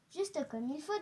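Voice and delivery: ordinary delivery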